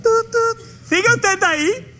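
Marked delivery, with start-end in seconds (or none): 0.0-0.6 imitating phone beep. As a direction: make a hold tone with your mouth.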